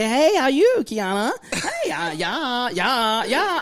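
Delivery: high, lilting chit-chat with baristas; campy greeting. Acted.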